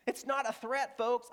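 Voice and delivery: said high through laughter; laughing. Real, not acted. Laughing while preaching.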